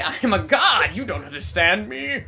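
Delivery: loud, high god-like manic rant; megalomaniac rant. Retold or imitated. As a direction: roar it like a deranged god. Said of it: "you throw manic in there".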